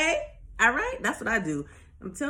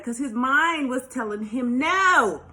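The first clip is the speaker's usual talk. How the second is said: loud, higher, stretched-out NO; loud emphasis; 4 semitones higher, 7 dB louder, slower than usual. Real, not acted. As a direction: build to a drawn-out, preachy holler. Driving home the lyric's lesson.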